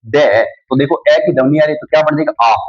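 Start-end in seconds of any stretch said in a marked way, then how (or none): none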